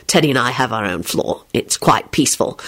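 low, understated reply; truthful. A narrator's reading. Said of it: "he replied truthfully".

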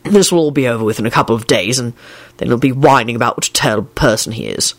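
low, mildly stern voice. A narrator's reading. Man reassuring a crying woman, stern. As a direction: lay it down firmly in a low voice. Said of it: "he said sternly".

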